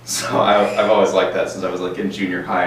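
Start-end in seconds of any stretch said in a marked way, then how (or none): none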